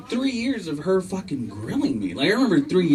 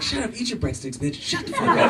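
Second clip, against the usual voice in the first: mock snapped aside; 9 semitones higher, 4 dB quieter than usual. Joking aside to daughter.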